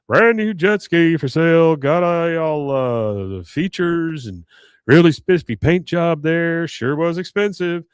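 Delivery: mock vendor's patter with stretched words; imitated sales pitch. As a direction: call it out slowly like a reluctant salesman. Acts out Hank's sales pitch.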